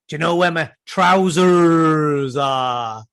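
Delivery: put-on slow drawn-out Bristolian accent, a child's voice done by her dad. Father imitating his daughter's new Bristol accent, slow Bristolian drawl. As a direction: stretch it out in a broad Bristol burr. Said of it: "She turned into a Bristolian".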